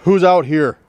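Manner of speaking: high, loud call into the night; calling into the dark. Real, not acted. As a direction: shout into the darkness.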